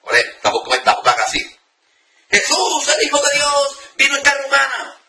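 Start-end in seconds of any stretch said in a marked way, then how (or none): 2.3-5.0 shouting. Shown as a demonstration. Preacher showing the wrong way, shouting the prayer. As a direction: yell the name of Jesus. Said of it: "No gritando".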